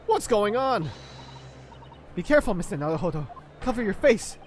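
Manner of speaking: loud, high, urgent cries in the smoke; alarmed shout. Acted. Partner shouting warnings to the lawyer, loud and high. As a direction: shout a warning through the chaos. Voicing game characters in alarm.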